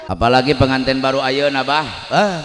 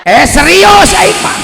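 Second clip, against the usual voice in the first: shout; 10 dB louder, slower than usual. Real, not acted. Preacher suddenly yells.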